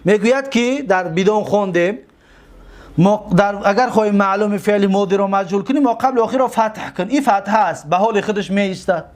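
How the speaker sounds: normal talking voice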